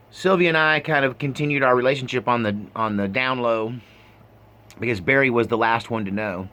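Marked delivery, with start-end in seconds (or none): none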